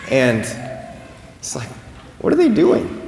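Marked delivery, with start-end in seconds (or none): 2.2-3.0 high-pitched puzzled question; baffled onlooker. Acted. Passer-by spots people outside holding branches, puzzled. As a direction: ask it high, as a bewildered passer-by.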